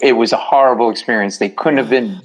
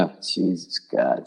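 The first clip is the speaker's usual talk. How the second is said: much lower, much softer, slow exhausted moan; weary groan; 8 semitones lower, 10 dB quieter, slower than usual. Retold or imitated. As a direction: sink into despair under the pain. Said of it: "I'm like".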